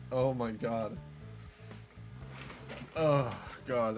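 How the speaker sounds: ordinary talk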